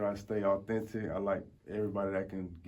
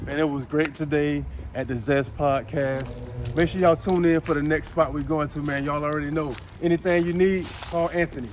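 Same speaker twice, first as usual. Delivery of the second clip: loud, high hype host; hype vlog outro; 6 semitones higher, 11 dB louder than usual. Acted. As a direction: pump up the viewers.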